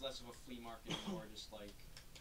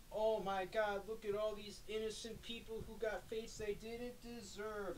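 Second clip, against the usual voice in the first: mock tragic anime speech; 9 semitones higher, slower than usual.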